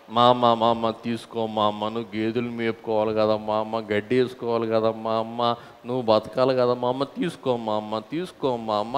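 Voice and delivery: soft, fast wheedling plea; mock pleading. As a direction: coax softly, like begging a mother. Mocking a begging plea to mother.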